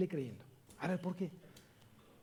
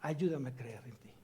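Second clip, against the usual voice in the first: softer, lower, humble plea; quiet prayer; 6 semitones lower, 6 dB quieter than usual. Pretend. Believer praying to the Lord for faith, softly. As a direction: murmur it as a humble prayer to God.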